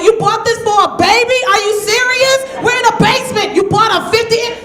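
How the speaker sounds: high, loud shocked rant; can't believe the TV; outraged disbelief. Retold or imitated. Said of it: "I was like".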